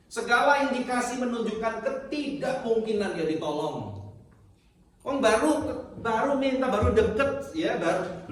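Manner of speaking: high, loud impassioned preaching; a preacher voicing the woman's desperate begging, high and loud; preaching at full force. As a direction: plead her case to the congregation at full cry.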